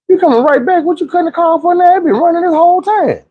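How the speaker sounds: put-on high-pitched incredulous kid complaint; indignant disbelief. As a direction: protest in a strained high voice. Kid's annoyed thought at friend's mom.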